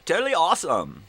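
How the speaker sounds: loud, high delighted cheer; excited. Real, not acted. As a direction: whoop it out with glee. Laughing about prank.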